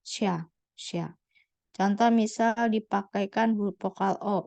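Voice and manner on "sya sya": sya repeated quietly, soft s gliding to a. Shown as a demonstration. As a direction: model the syllable sya twice. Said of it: "jadi dibacanya sya". (ucapkan sya pelan dua kali)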